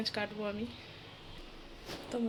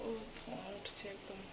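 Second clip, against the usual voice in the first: speaking softly; 8 dB quieter than usual. Real, not acted. Mother talking to viewers while her baby sleeps, hushed. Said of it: "আস্তে কথা বলছি কারণ আমার বাবু উঠে পড়বে".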